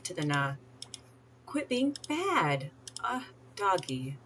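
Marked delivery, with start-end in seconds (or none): 1.5-4.2 slow, high-pitched mock telling-off; playful scolding. Player telling the naughty doggy off, playful drawn-out scolding. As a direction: drag it out like scolding a pet.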